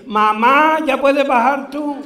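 loud high call with a laugh; calling up to mum. Acted. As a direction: shout up the gangway to mother. Old woman's son calls up.

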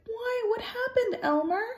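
high, startled motherly voice; mother dog alarmed at her pup; shocked mother. A narrator's reading. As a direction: gasp it out in worry. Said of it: "Glory Bell was so shocked".

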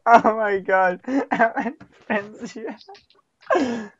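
words breaking up with real laughter; helpless, drawn-out laughing question; laughing so hard. Real, not acted. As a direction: fight to ask it through giggles. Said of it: "I'm laughing so hard".

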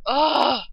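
loud, exasperated ugh; frustrated groan. Real, not acted. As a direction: let out a fed-up groan at the lag. Frustrated at the lag.

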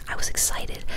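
ordinary delivery, nothing marked

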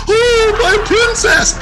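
very high, loud mock wail; mock bawling. Imitating Orange Ball Guy running up to his dog, mock bawling. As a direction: imitate an owner wailing over the dog. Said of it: "He's bawling".

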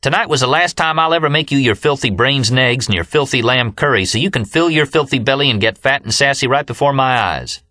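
a sustained high-pitched tirade; angry tirade. A narrator's reading. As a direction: keep railing at him, spitting each filthy. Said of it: "yelled Leanne".

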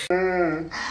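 drawn-out mock wail, falling low; imitated pained wail.

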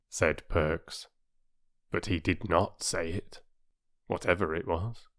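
usual speaking voice

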